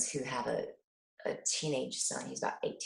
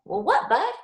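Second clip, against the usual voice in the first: put-on high, louder, coaxing question to a child; gentle curious mom; 10 semitones higher, 9 dB louder than usual. Mother coaxing son to tell the truth, high and gentle. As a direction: invite the kid to speak. Mom answering son's confession.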